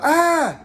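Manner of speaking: short high acted shriek; loud, startled mock scream; retold scream. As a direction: shriek as if suddenly frightened. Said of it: "And she screams".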